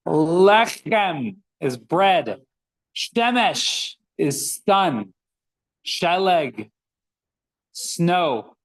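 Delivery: Lechem and Shemesh stretched out very slowly; slow teaching voice. Shown as a demonstration. Teacher teaching Hebrew words to the class, very slow. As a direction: lay each word out slowly for learners. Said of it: "teacher models words slowly".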